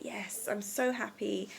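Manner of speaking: whispered, savoring the moment; hushed, grateful whisper. Shown as a demonstration. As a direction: breathe it out as a happy whisper. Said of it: "be like".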